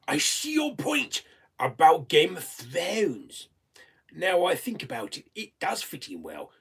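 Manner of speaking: a weak stab at a famous politician, half-hearted; failed Donald Trump. Acted. Answering a viewer's request to talk like a president, failed mock impression. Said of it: "in the style of Donald Trump".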